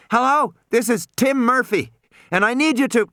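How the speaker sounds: put-on high-pitched young caller, earnest and insistent; high, urgent plea to be heard; urgent boy on radio. A narrator's reading. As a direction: call into a radio as an anxious boy. Boy urgently calls ship by radio.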